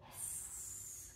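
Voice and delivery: a soft drawn-out hiss, no voice; snake hiss. Shown as a demonstration. Teacher hissing like a cobra for the class. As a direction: hiss like a snake rising from grass. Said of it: "and hiss".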